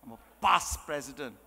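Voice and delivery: "past" stretched and weighted in a low voice; emphasis on PAST. Shown as a demonstration. Speaker mimicking a past president, emphasis on "past". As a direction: dwell pompously on the word "past". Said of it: "Emphasis on past".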